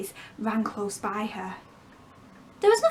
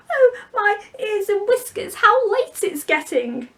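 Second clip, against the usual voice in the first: higher, louder, a frantic crying rabbit; 10 semitones higher, 6 dB louder, slower than usual. Acted. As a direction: panic like a rabbit running late. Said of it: "hear it say".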